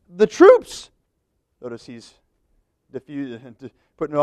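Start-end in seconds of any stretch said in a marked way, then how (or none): 0.1-0.9 high, loud blame-shifting Saul; Saul deflecting. Acted. Saul shifting the blame onto his troops, high and loud. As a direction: pass the buck, pitched up and louder.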